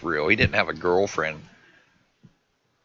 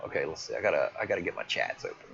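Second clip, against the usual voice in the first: ordinary delivery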